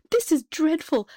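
high-pitched fretting; dismay. A narrator's reading. Queen frets to the king over the curse, dismayed.

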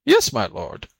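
high, squeaky submissive reply; small high voice of feigned obedience; meek jackal. Acted. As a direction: answer timidly as a servile jackal. Jackal meekly answers the tiger.

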